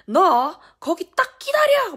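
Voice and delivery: high, loud, drawn-out order; retold mother yelling a warning; angry shouted threat. Enraged mother orders child to wait.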